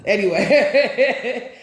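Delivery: laughing. Real, not acted. Laughing while saying it.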